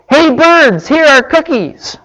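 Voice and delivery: high, carrying call; storybook shout. A narrator's reading. Frog offers cookies to the birds, high shout. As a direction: call out far across the yard. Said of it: "He shouted in a loud voice".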